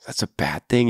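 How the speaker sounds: hushed whisper to the listener. Real, not acted. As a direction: lean in and whisper the warning. Warning aside to listener.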